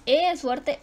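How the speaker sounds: ordinary talk, nothing marked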